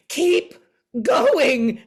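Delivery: high, loud, anxious plea in a mock director voice; frightened urging. Music supervisor in the wings, frightened, urging her on with a high loud plea. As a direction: urge her on like a scared director. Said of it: "he's looking really frightened".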